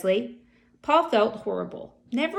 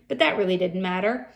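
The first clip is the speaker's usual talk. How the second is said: plain reading voice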